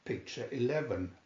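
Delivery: ordinary delivery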